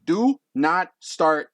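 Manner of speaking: syllable by syllable. Acted. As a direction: lay down the rule one word at a time. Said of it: "with each syllable".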